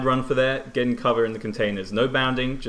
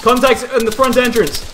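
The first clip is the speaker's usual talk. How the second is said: loud, high urgent shout; shouted callout; 13 semitones higher, 9 dB louder than usual. Real, not acted. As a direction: yell the warning to the squad. Calling out enemy contacts.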